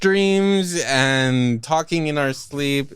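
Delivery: slow, drawn-out ominous tease; spooky joke. Real, not acted. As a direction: drag it out like a spooky trailer. Spooky joking summary.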